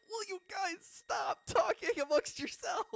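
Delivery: high, loud, exasperated scolding; exasperated teacher. Retold or imitated. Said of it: "his hands are up".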